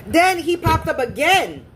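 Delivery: normal talking voice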